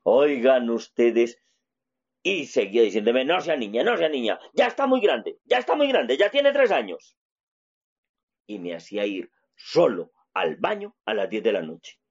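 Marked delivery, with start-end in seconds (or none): 3.2-7.2 the father's nagging voice climbing much higher; mocking father. Father telling his three-year-old son he is grown, nagging higher. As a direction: nag him that he's a big boy now. Father insists boy is big.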